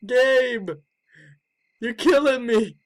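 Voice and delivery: ordinary talk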